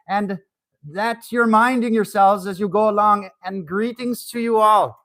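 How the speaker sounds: loud, cheery Irish brogue. Pretend. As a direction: greet the crowd like a jolly Dubliner. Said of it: "A real Irishman, y'all".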